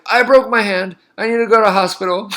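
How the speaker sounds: high, loud, hurried mock panic; sudden change of heart. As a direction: flip from rage to comic alarm. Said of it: "how quickly you change".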